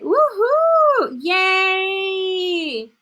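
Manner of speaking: high whooping woohoo, then a shouted yay; excited cheer. Real, not acted. Teacher cheers Raúl's right answer with an excited whoop. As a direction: cheer wildly for the class.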